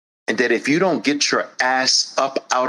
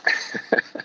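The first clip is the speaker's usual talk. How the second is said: breathy laugh; 6 dB quieter than usual. Real, not acted. Laughs at own impression.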